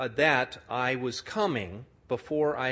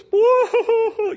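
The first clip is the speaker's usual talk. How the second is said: high, loud hammed-up boo-hoo sobbing; mock public wailing; 13 dB louder than usual. Woman in Bible study wailing, hammed-up loud sob. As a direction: wail it out like a caricature of public weeping. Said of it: "like that in our Bible study".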